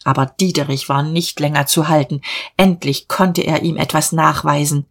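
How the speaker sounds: plain reading voice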